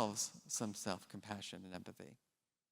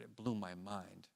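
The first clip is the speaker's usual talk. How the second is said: usual speaking voice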